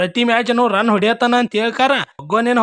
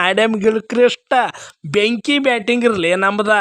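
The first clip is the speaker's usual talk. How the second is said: ordinary talk, nothing marked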